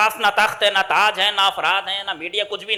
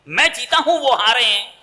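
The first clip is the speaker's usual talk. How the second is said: arrogant insistence; 6 semitones higher, 7 dB louder than usual. Acted. Yazid insists he won.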